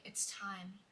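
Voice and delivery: very soft, ominous statement; softly acted spooky line; quiet, eerie announcement. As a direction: say it hushed and even. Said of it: "And then you're like".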